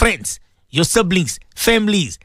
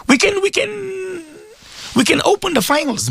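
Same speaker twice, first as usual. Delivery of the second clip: tearful plea; 13 semitones higher than usual. Real, not acted. Preacher pleads about sins.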